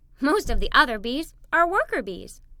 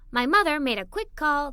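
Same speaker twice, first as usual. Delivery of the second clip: plain reading voice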